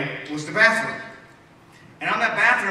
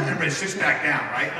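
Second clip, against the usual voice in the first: ordinary delivery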